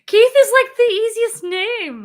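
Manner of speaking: high, loud laughing disbelief. Real, not acted. Laughing at chat's misspelled name.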